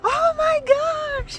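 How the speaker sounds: very high, drawn-out tearful squeal; overwhelmed squeal. Retold or imitated. Telling viewers her reaction to the surprise visitor, squealing tearfully. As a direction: re-enact a loud, slow cry of joy.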